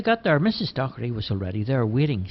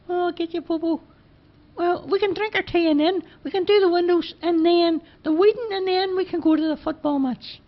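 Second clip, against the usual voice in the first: put-on high, louder boyish voice; Charlie's voice, eager; 4 dB louder than usual. Charlie pleading with Bobo, high eager boyish voice. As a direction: pitch it up, keen to get going.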